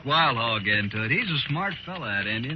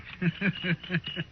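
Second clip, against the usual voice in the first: pleased, sneering laugh; gloating chuckle; smug laugh; 7 semitones higher, 6 dB quieter than usual. Acted. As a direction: laugh like he's proud of it. Spicer laughs at Judas sheep jab.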